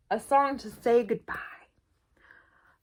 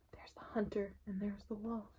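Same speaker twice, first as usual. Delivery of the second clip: a tense storyteller's whisper; 3 semitones lower, 18 dB quieter than usual. Acted. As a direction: hush it like the hunter might hear. Hushed aside during tense story.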